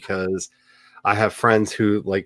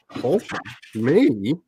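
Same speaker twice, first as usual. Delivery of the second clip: slow, high mock indignation; mock offended; 7 semitones higher, slower than usual. Rebuking someone who called her wholesome, mock outrage. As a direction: ham up the outrage.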